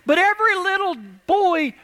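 preaching refrain. Acted. Preaching key line loudly.

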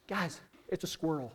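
soft, unimpressed dad; deadpan letdown. Retold or imitated. Father telling his scared kids, quiet deadpan. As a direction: drop it quietly after all the panic.

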